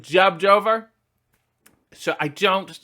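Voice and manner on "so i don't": usual speaking voice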